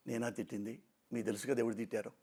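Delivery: normal talking voice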